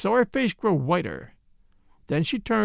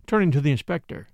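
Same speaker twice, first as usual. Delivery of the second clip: ordinary delivery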